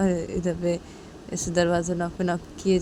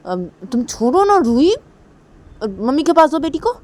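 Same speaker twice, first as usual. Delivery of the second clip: high, loud urging; loud plea; 8 semitones higher, 6 dB louder than usual. Acted. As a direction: urge her to hand the girl over. Pleading loudly for the daughter. (ऊँची आवाज़ में आग्रह)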